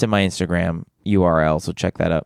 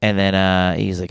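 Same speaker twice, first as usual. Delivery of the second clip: usual speaking voice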